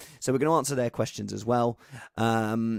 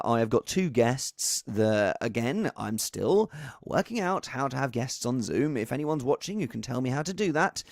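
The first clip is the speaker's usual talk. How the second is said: ordinary talk, nothing marked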